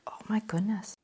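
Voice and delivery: soft, awed whisper; breathy little gasp of real surprise in a whisper; hushed amazement. Real, not acted. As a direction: whisper it under your breath in wonder. Quiet awe at a paint swatch.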